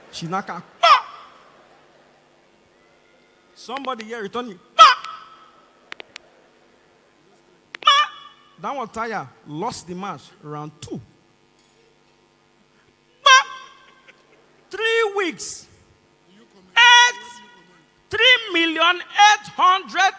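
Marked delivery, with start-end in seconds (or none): 0.8-1.3 short loud high yelp; imitated tennis grunt. Imitates tennis player hitting ball. 4.8-8.1 short loud high yelp; imitated tennis grunt. 13.3-13.5 short loud high yelp; imitated tennis grunt. 14.7-15.7 THREE WEEKS cried out high; incredulous outcry. Real, not acted. Outraged repeat of the time.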